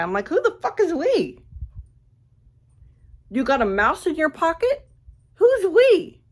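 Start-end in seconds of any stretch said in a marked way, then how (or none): 0.3-1.4 high-pitched mocking disbelief; incredulous retort. Scoffing at the man who says we, high mocking disbelief. 3.3-6.2 high, sharp scornful disbelief; mocking retort. Ridiculing his we, high and loud in scorn.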